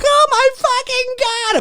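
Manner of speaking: very high, shrill-free panic cry; hammed-up high panicked cry; Laurie freaking out. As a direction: squeal it in terror. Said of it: "Laurie's all like".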